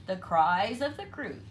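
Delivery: ordinary delivery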